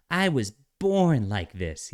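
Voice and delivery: slow, a hint of indignant whine; slow mock-wounded line; mocking soy voice. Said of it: "Yes, they were all born like this".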